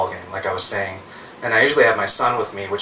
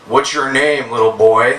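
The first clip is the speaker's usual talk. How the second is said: put-on louder, overfriendly drunk act; drunk bum; 3 semitones higher, 6 dB louder, slower than usual. Drunk bum asks mom's little son his name, pushily friendly. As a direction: play a drunk pestering a small kid. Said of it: "in that drunk way that bums think they're being friendly".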